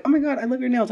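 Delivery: high, bubbly mock compliment; gushing compliment. Restaurant staff complimenting her, in a high gush. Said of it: "And they're like".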